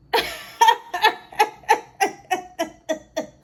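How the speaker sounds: high, loud staccato cackle; helpless loud laughter at your own joke; real laughing fit. Real, not acted. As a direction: burst into a long, high string of laughs. Cracks up at own petty remark.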